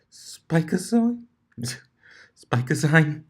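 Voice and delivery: high, slow, amused echo of the name; laughing disbelief. Acted. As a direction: savour the silly word with a laugh.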